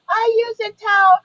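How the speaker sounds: put-on high little-girl voice. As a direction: babyish and prim.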